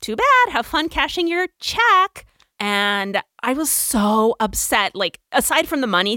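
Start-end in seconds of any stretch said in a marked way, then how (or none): 0.0-2.3 mocking boss. Boss mocks her.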